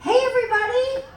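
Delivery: loud, high, bubbly greeting to the crowd; excited whoop. Real, not acted. Panelist cheering the cruise audience, loud and excited.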